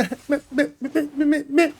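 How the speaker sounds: high staccato sung babble with laughter; silly high vocal riff, laughing; playful vocalizing. Acted. Gamer tails off his song, high silly riffing.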